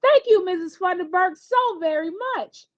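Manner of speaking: put-on a mildly overjoyed girl thanking her teacher; excited thanks. A narrator's reading. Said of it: "Overly excited, Sunny begins to shout".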